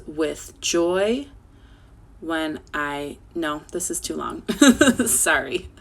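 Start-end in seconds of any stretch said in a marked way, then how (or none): 4.5-5.0 sudden loud laugh at herself; short laugh. Real, not acted. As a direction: laugh off the slip. Laughs at own mistake.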